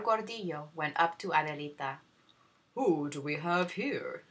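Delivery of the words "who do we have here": lightly voiced older man, slow; curious host asking slowly; a hint of a genial old gentleman; host greeting. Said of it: "he asked".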